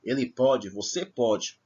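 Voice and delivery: pode with open O each time. Shown as a demonstration. Teacher repeating the present tense, open O in pode. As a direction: open up the stressed vowel of pode. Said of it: "está no presente".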